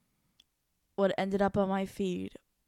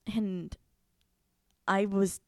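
usual speaking voice

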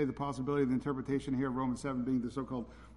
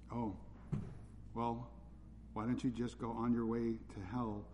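sarcastically sweet, a bit softer; mock nice; 3 semitones lower, 7 dB quieter than usual. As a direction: pretend to be polite while condemning. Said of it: "I'll try to say that as nicely as I can".

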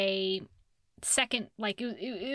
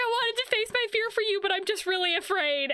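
high-pitched imitation of a frightened confession; mock terrified wailing; 8 semitones higher than usual. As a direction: wail it in a hammed-up, scared high voice. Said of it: "The whole time he's crying and screaming 'cause he's so terrified".

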